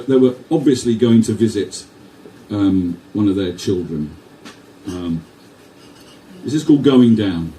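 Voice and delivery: ordinary delivery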